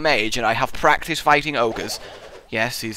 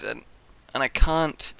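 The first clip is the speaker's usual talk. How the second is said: ordinary talk, nothing marked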